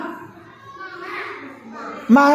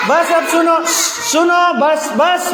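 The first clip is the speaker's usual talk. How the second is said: raised voice for attention; 5 semitones higher, slower than usual. Real, not acted. Teacher quieting noisy kids, raised, higher firm voice. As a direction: call the class to order, voice raised and high. Teacher hushing restless children.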